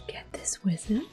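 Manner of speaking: ordinary delivery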